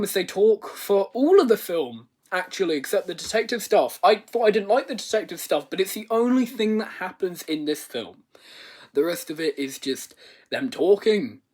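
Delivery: ordinary delivery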